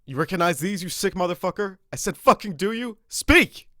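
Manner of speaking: loud, high furious shouting; most aggressive voice. Acted. Cop interrogating the killer with the photos, shouting furiously. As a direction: scream it in his face to break him. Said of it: "I said it in the most aggressive voice I could".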